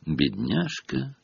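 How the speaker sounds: soft, slow sighed pity; sad sigh. A narrator's reading. Hermione pitying Myrtle, a soft sad sigh. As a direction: sigh it out sadly. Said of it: "грустно вздохнула Гермиона".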